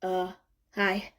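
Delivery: hesitant, unsure hello; awkward. A narrator's reading. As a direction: caught off guard, mumbling. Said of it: "the stranger replies awkwardly".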